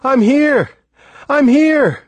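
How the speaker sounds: high, loud call across water; yelled. A narrator's reading. Stranded boy calls to an approaching boat, yelling. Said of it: "I yelled".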